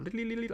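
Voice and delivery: very high, soft babbled "lee-lee" trill; scrambled-brain noise. Acted. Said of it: "when my brain's going".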